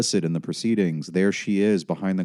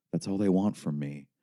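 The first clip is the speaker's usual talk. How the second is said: ordinary delivery, nothing marked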